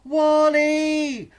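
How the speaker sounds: sing-song shouting for a lost wolf. Acted. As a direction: call his name into the dark wood.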